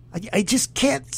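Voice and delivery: ordinary delivery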